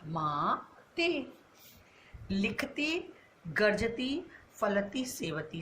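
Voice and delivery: माते stretched out very slowly; slow dictation. Shown as a demonstration. Teacher dictating the answer माते to the class, very slow. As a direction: draw out माते for writing down. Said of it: "कौन सा अशुद्ध शब्द है?".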